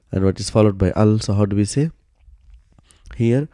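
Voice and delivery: normal talking voice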